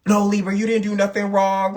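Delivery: lightly mocking fawning friend voice. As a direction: coo like a yes-man friend.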